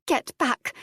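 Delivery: warning. A narrator's reading. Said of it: "Adair warned him".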